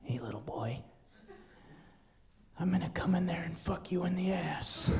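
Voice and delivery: hoarse menacing whisper from a stranger; creepy whisper. Retold or imitated. Prank caller whispering threats to a child over the phone. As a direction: breathe it like a predator on the phone. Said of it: "And he goes".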